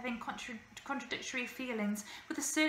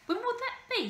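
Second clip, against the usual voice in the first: put-on eager, higher boyish question; 13 semitones higher, 3 dB louder than usual. A narrator's reading. Charlie eagerly asking the bearded lady about the show. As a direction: ask it bursting to know. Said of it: "he asked eagerly".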